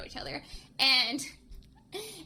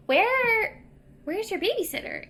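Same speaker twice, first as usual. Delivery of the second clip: imitated puzzled question; 10 semitones higher than usual. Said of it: "And he's like".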